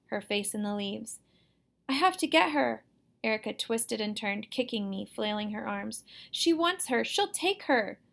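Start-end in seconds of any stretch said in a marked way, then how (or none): 1.9-2.8 put-on higher, louder, insistent child; distressed, high-pitched urgency; desperate plea. A narrator's reading. Girl struggles to reach dropped doll. 6.3-8.0 high-pitched, desperate warning; upset child's high voice; frantic child. A narrator's reading. Girl struggles, crying, fearing doll's loss.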